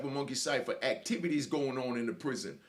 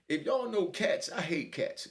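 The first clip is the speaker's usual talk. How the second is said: ordinary delivery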